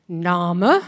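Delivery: lightly voiced teacher asking in German; German teacher's question.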